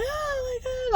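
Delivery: high, thin imitated wail; hammed-up crying retold from a dream; mock bawling. Dreamer left alone with a stranger, mock bawling. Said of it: "And I'm just bawling".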